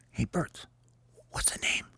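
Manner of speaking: hushed captain asking his team; very soft, secretive question; whispered. Acted. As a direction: whisper to the huddle. Hope huddles with his birds.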